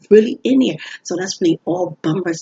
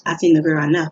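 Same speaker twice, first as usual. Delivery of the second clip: ordinary talk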